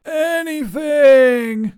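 very high, loud, drawn-out ANYTHING; desperate wail. A narrator's reading. Wizard begging to escape the sander, wailing.

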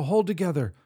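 pleading, loud; will it to hold; urging. Acted.

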